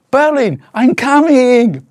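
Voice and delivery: high, slow, loud cry of joy; excited shout. Acted. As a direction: call out eagerly to the city. Excited arrival in Berlin.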